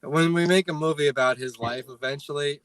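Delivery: ordinary delivery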